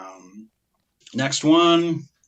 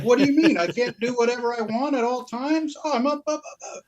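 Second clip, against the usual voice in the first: high, whiny put-upon voice, pitched way up; high-pitched mock whine of a clueless filmmaker; mocking whiny director; 9 semitones higher than usual. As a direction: whine it like an entitled hack caught out. Mocking the hack director's reply.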